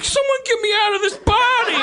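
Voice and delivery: high, desperate mock plea; trapped big strong guy. Strong young guy begging to escape his body, high mock despair. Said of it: "young, big, strong guy who's like".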